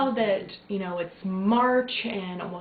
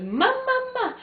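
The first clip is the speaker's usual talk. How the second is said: put-on high, loud baby voice calling "Ma, ma, ma"; squeaky imitated baby call; imitating baby babble; 5 dB louder, slower than usual. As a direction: play the baby calling out for mom, delighted. Baby calling mama in morning.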